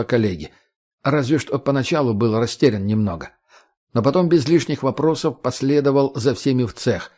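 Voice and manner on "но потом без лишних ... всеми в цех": ordinary delivery, nothing marked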